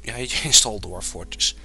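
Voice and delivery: ordinary talk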